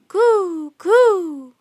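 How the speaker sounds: loud high dove coo; bird cooing. Acted. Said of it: "Teddy said loudly".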